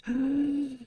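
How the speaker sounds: sharp asthmatic gasp for air; wheezy gasp. Real, not acted. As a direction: gasp for breath. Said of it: "I can't breathe".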